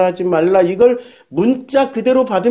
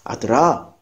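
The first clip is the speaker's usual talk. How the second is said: tender father; 6 semitones lower, slower than usual. Retold or imitated. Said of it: "거기서 호칭이 정말 중요해".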